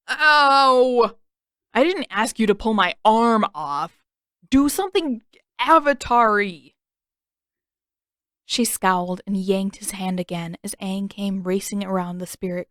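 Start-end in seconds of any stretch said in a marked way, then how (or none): 0.1-6.7 loud, higher exasperated yelling; complaining yell. A narrator's reading. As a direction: whine loudly while being yanked. Sokka being pulled, complaining.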